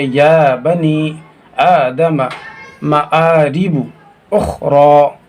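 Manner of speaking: या बनी, आदम, मारिबू with held long vowels; joined-up reading. Shown as a demonstration. Teacher showing how joined letters read, आदम with long opening aa. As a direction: stretch the aa in या and आदम. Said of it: "मिलाएंगे तो यूँ पढ़ेंगे".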